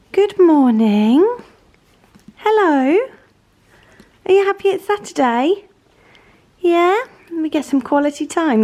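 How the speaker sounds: loud, high-pitched doggy greeting; talking to a pet. Real, not acted. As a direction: greet your dog in a lifted sing-song. Greets and chats to her dog.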